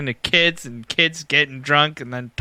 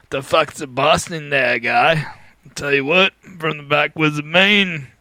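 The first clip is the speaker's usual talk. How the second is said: put-on Maine accent. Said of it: "That's how they talk up there".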